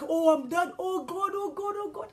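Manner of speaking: high, loud "oh God"; panicked cry. Retold or imitated. Driver braking hard, crying out high in panic. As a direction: gasp it in terror. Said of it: "I'm like".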